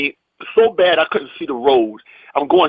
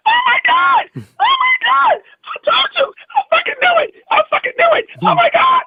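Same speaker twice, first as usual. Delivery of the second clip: very high falsetto imitation of a woman shrieking; screaming excited wife. Wife hears her husband got the show, mock ecstatic shrieking. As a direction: squeal it out, hammed-up joy.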